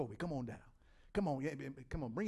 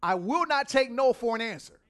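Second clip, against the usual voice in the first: loud, forceful refusal, higher; personified Aggressive, firm and loud; 5 semitones higher, 10 dB louder than usual. Acted. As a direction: insist like you won't back down. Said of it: "Aggressive says".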